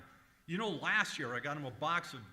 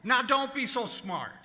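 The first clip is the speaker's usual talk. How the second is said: loud, higher, offended; indignant; 3 semitones higher, 9 dB louder than usual. Acted. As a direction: snap back at the clerk. Annoyed customer snaps at clerk.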